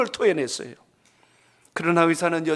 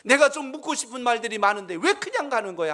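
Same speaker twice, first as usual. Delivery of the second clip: rising, louder grumble of outrage; father fuming as the doctor walks off; indignant shout; 5 semitones higher, 3 dB louder than usual. Retold or imitated. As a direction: complain loudly at the rude doctor. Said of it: "하고 또 소리를 지릅니다".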